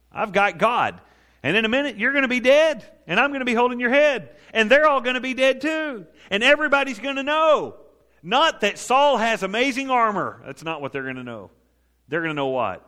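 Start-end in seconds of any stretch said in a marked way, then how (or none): 0.1-10.4 high and loud, gloating at the enemy; triumphant boast. Acted. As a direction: crow over the giant's coming defeat. Preacher voices David boasting in God.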